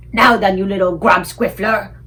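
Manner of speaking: loud, menacing giant boom. A narrator's reading. Flesh Lump Eater booming at the BFG. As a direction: boom it down at him. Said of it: "boomed the Flesh Lump Eater".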